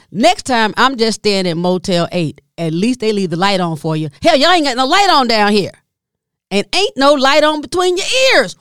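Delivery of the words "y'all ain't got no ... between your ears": normal talking voice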